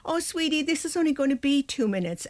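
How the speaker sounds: put-on high, pleading whine to a crying baby; whiny anxious parent. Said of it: "our voice has this whiny effect to it".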